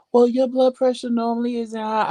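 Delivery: high-pitched mock nurse voice; imitated nurse. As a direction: mimic a fussy nurse up high. Mimics second nurse's remark.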